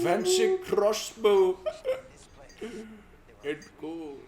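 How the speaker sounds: high, drawn-out cartoonish character; game character. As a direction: stretch it out in a silly high voice. Voices a game character handing over.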